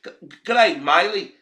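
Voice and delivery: hammed-up Aussie greeting; corrected accent. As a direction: pitch it up and call out "Good, good day". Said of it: "no, that's British".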